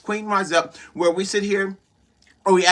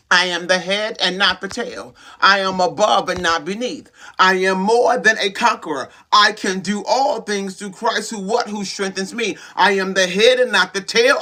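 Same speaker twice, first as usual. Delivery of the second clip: ordinary talk, nothing marked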